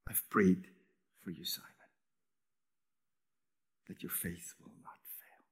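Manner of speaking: breathy whisper of a prayer; hushed voice; whispered promise. Acted. Tender prayer to Simon.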